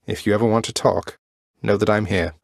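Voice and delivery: ordinary talk, nothing marked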